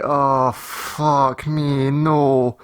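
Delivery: long, drawn-out dismay; mock despair. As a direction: stretch the words into a slow groan of disbelief. Reacting to pregnancy test picture.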